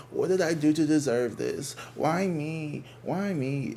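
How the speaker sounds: high, whiny poor-me imitation; mock victim. Mimicking victim talk to dismiss it, mock plaintive. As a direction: play up the self-pity, pitched high. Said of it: "that's some victim shit".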